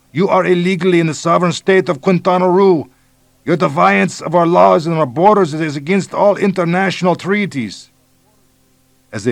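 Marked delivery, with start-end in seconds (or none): 0.1-7.9 put-on high, loud bellow with Mexican accent; shouting. A narrator's reading. Police chief bellowing at American invaders, loud shout. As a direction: bellow it from the jungle. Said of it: "A deep voice bellowed out".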